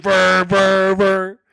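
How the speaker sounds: buzzing "brr" pulses like blaring music; rhythmic mouth-made bass thumps; imitated club noise. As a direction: mimic music drowning out the conversation. Imitating loud club music drowning speech.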